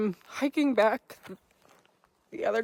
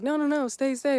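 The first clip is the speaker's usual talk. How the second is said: mock pushy host; 7 semitones higher, 4 dB louder than usual.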